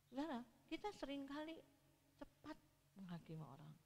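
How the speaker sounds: ordinary talk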